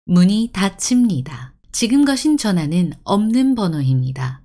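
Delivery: measured, formal public-address delivery; automated announcement. Shown as a demonstration. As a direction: recite like a recorded operator message. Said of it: "Yeah, like this".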